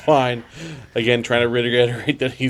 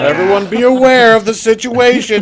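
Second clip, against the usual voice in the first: higher, louder formal call; announcement; 11 semitones higher, 9 dB louder than usual. Pretend. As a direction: announce it like a loud official. Joins mock announcement.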